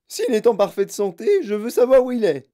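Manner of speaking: high, loud demand; sharp, lively retort. A narrator's reading. Mother demands her son's whereabouts, sharp retort. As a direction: demand it vehemently. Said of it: "dit vivement Madame Gloop".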